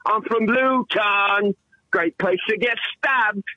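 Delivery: slow, high-pitched English greeting; hammed-up English accent. Greeting Claire, a new friend in the UK, hammed-up English accent. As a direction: stretch it out in a playful English accent.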